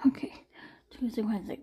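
whispered okay to herself; a quick whisper while counting days; whispered aside. Real, not acted. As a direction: breathe it out under her breath. Muttering to herself checking dates.

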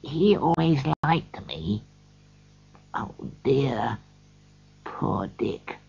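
put-on old Scrooge remembering a lost friend, quietly. A narrator's reading. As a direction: murmur it fondly to the ghost. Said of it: "said Scrooge quietly".

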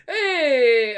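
high, loud, delighted shout; excited cry. Real, not acted. Player spots a birthday on the document, excited cry. Thinks traveller shares their birthday.

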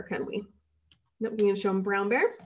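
ordinary delivery, nothing marked

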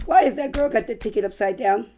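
ordinary talk

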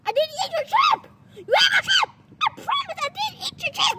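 high shrieking protest; screaming denial. Acted. Denying while being screamed at.

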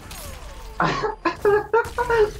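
high-pitched burst of laughter; laughing. Real, not acted. Laughing at the game.

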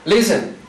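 "Listen" stressed on LIS, falling away after; stress on first syllable. Shown as a demonstration. Teacher showing where the accent is, "Listen" stressed at the start. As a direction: hit the start of "Listen", let the end drop. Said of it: "Está al principio".

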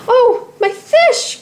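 very high, slow cry of joy and shock; surprised cry. A narrator's reading. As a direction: cry out on finding the lost fish. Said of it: "Kana cried".

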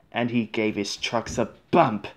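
ordinary delivery

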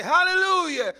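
high, loud Hallelujah cry; shouted hallelujah. Shown as a demonstration. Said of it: "I want you to say".

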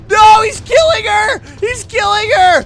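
high, loud panic; alarmed shout. Real, not acted. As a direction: cry out at the attack. Witnesses attack in game.

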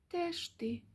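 tiny, high, hushed mouse reply; very quiet. A narrator's reading. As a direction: sheepishly answer under your breath. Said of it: "зовсім тихо відказали Круть і Верть".

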